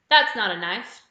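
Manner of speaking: a loud Australian accent, hammed up; Aussie accent. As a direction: do a big Crocodile Dundee Aussie. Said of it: "My Aussie accent is not very fun".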